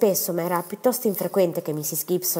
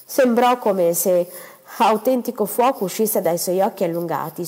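ordinary delivery, nothing marked

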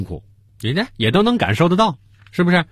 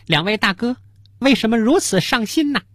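higher-pitched fox waiter asking two customers; 狐狸服务员; 9 semitones higher than usual. Acted. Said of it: "这时候有一狐狸，就是那个服务员儿". (狐狸服务员提高嗓音询问)

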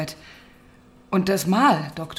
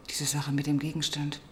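whisper; 3 semitones lower, 10 dB quieter than usual. Acted. Inspector muses to herself.

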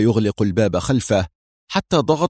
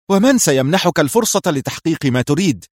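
nervous stern shout; 7 semitones higher than usual. A narrator's reading. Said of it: "يهتف في عصبية صارمة".

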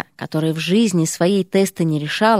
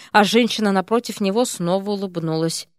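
ordinary delivery

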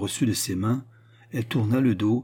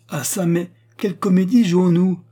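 stern queen, voice raised high and loud; 9 semitones higher, 8 dB louder than usual. A narrator's reading. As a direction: demand it severely, pitched well up. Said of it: "dit sévèrement la reine". (ton sévère de reine, voix haute et forte)

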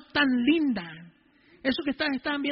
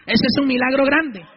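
full-force preaching; 7 semitones higher, 11 dB louder than usual. Real, not acted. Preacher relaying God's order to congregation, full-force preaching.

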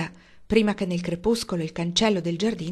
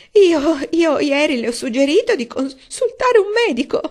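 much higher, louder tearful moan; wailing; 8 semitones higher, 10 dB louder than usual. A narrator's reading. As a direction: moan it in despair. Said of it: "gemette missis Frederick". (lamento acuto e disperato)

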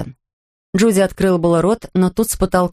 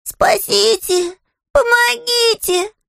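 high, slow bear voice crying for help; small bear crying for help; much slower than usual. Acted. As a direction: wail for help like a little bear. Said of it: "отвечал Паддингтон".

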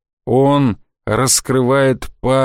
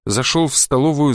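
ordinary delivery

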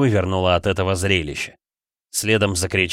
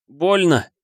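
sharp cry of pain, higher; 6 semitones higher, 3 dB louder than usual. Acted. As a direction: cry out as if cut. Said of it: "прокричал Флинн".

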